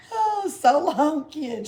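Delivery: high, slow, loud farewell call; cheerful high farewell. A narrator's reading. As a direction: sing out goodbye gaily. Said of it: "she cried gaily".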